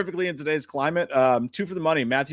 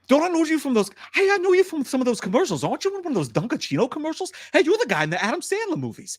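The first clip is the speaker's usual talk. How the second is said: high, eager tough-guy impression; Wahlberg; 12 semitones higher, 3 dB louder than usual. Co-host recognizing Pacino from commercials in line, excited Wahlberg impression. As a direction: pester a celebrity with excited recognition. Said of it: "Could I get a Wahlberg".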